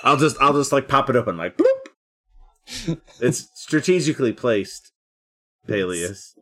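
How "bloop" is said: playful bloop noise, pitched high; quick silly pop effect; mouth pop sound. Pretend. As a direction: pop the sound out like a cartoon bag. Mimics bag popping open.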